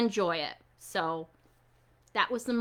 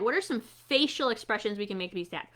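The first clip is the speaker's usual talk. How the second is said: ordinary delivery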